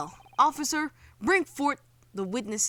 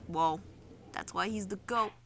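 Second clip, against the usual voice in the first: usual speaking voice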